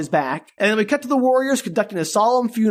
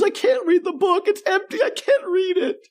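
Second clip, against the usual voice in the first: much higher, whiny fake sob; mock crying Ryan; 10 semitones higher than usual. Ryan crying to the Roos, high mock sob. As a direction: wail it like a crybaby. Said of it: "crying and telling the Roos".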